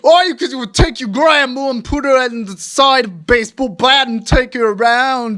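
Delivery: high-pitched, mushy Aussie-Cockney ramble; thick put-on Aussie accent, as if through molasses; muddled Australian-Cockney accent. Host rants back at his critic, muddled Australian-Cockney accent. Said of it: "like you have molasses in your mouth and".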